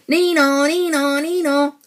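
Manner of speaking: high, two-note siren wail; imitated siren. A narrator's reading. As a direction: wail nee-naw like an ambulance.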